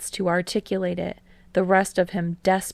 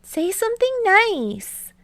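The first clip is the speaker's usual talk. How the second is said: higher, playful coaxing; Hinata urging, teasing; 13 semitones higher, 3 dB louder than usual. A narrator's reading. Hinata teasingly urging Kei for a compliment, much higher. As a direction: tease him into it. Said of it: "Hinata urges, teasing".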